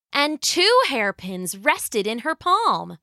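plain reading voice

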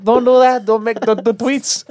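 high, hammed-up foreign accent; Melania Trump. Wife tells Donald to stop tweeting, high foreign-accented Melania Trump. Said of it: "that's my Melania Trump".

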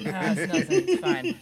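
high sheepish cover-up; sheepish captain. Acted. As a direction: brush it off nervously. Captain caught lifting barrel.

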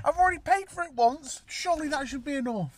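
high, loud exasperated complaint; furious, fading toward disbelief; angry rant. Real, not acted. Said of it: "I'm very angry, can you tell?".

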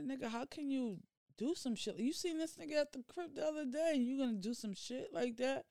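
high-pitched, indignant telling-off; retold indignant scolding. Sister confronting her brother, indignant.

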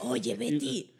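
low slurred imitation of [name]; deep drunken crooner voice. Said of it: "Haz, haz la voz de".

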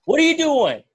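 high, loud mock dad shout; imitated yell. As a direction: shout it like a startled father. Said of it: "uh, yell".

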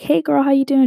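put-on high, bright hey girl; friendly greeting to a classmate at Starbucks; cheery greeting. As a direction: greet her sunny and up. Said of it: "I was like".

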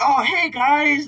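high, loud and slow hey guys; an imitated relative's slow, cheery hello; drawn-out breezy greeting. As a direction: stretch the greeting out lazily. Uncle's greeting at sliding door.

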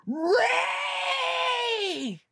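long high shouted cry; Finn shouting Rey. Retold or imitated. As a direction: hold a dramatic shout of her name. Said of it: "throws them all the way down and goes".

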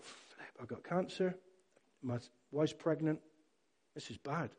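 hushed, halting inner dread; worried thought. Retold or imitated. New father fearing cancer to himself, hushed and worried. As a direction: think it aloud very softly, with pauses.

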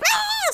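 high joyful squeal; mock scream. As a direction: squeal with delight. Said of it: "screaming in the car".

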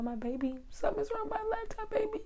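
very high, soft cooing voice. Real, not acted. Babying her broken laptop.

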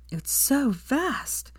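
high, drawn-out wonder; awe and wonder. A narrator's reading. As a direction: stretch it slowly upward, full of awe. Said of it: "finally said in awe and wonder".

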